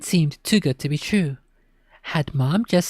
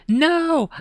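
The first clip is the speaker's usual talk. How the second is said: high, loud sudden yell; a sharp frightened yell; 13 semitones higher, 4 dB louder than usual. Acted. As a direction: shout it in panic. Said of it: "I yelled".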